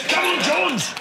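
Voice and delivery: high, loud rallying call. Acted. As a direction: shout it across the room. Calling out cheer.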